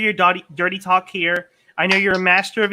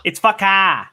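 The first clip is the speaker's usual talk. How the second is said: put-on Boston accent; slower than usual. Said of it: "without a Boston accent".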